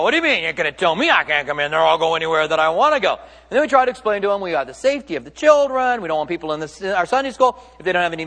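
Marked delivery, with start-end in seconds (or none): 0.0-3.1 fast, mildly indignant imitation; mock indignation. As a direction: rattle it off like an affronted visitor. Acted indignant visitor refused entry.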